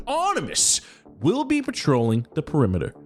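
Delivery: high, upbeat boss character voice; eager corporate villain boss; Kemi Joe voice. Acted. Patreon character continues briefing.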